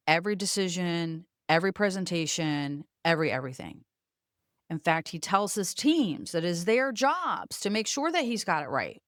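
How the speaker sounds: ordinary talk, nothing marked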